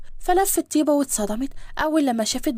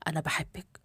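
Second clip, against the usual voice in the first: very soft, intimate admission; tender confession; 10 semitones lower, 12 dB quieter than usual. Acted. Man confessing his love to his bride, hushed and tender. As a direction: say it low and close, like a secret.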